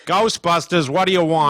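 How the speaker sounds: hammed-up New York voice; mock Long Island. Teasing cohost does a hammed-up Long Island voice. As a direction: bark it like a cabbie from Long Island.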